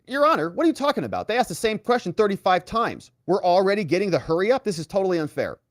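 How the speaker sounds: high, fast indignant complaint; indignant protest. Retold or imitated. Lawyer defending his colleague to the judge, indignant. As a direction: rattle off an outraged appeal, imitating a lawyer.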